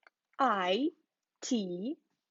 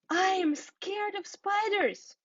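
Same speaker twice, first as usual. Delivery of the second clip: much higher, slow mock-fearful squeak; scared; 9 semitones higher than usual. The panicking smiley admitting its fear, high and mock-scared. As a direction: pretend a spider is right there.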